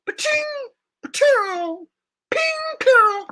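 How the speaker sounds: high, loud ricochet "ba-ting"; ricochet sound effect. Shown as a demonstration. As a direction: bullet pinging off metal. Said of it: "it makes the ricochet sound effect".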